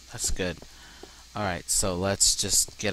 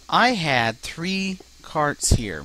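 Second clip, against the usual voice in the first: slow disbelief; 7 semitones higher, 5 dB louder, much slower than usual. Real, not acted. Carts vanished.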